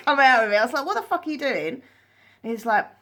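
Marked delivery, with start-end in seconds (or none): 0.9-1.8 high-pitched incredulous retort; retold appalled question; appalled disbelief. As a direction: replay your outrage, pitch raised. Recounting her shocked reaction to spit.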